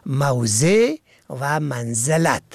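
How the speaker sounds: ordinary talk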